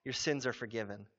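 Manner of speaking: hushed loving reassurance; tender Jesus. Retold or imitated. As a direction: comfort her like a gentle father. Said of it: "what does Jesus say".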